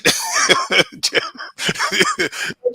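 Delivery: high, breathy burst of laughter; real embarrassed giggle; sudden laugh. Real, not acted. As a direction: crack up at your own slip. Laughs while fumbling a name.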